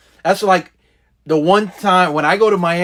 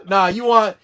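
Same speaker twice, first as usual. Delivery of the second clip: ordinary talk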